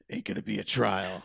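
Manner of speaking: gruff, lightly voiced movie cop; tough cop one-liner. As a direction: growl it like a hard-boiled cop. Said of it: "any cop does that".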